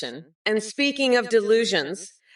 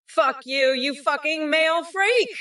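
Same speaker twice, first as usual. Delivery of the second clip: mock yell; 7 semitones higher than usual. Insulting chatter.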